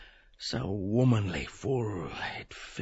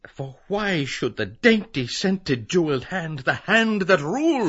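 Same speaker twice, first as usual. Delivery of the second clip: rising higher and louder with fervour; sudden fervour; 8 semitones higher, 8 dB louder than usual. Acted. Bloom pleads to the nymph with rising fervour. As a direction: burst into an eager plea. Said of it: "With sudden fervour".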